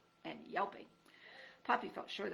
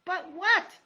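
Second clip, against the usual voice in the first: high, loud, panicky; scared mouse stumbling over words; frightened mouse; 11 semitones higher, 11 dB louder, much slower than usual. Acted.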